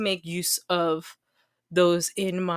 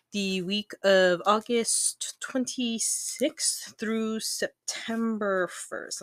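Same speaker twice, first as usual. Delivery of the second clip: slow, checking dates; 3 semitones higher, much slower than usual. Real, not acted. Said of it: "Let me verify that first date".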